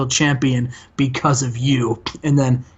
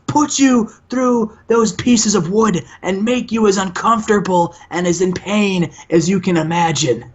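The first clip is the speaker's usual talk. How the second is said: much higher, slow hammed-up wrestler taunt; mock promo voice, pitched way up and dragged out; Bubba impression; 8 semitones higher than usual. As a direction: ham up a cocky brawler daring his rivals. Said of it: "Bubba goes".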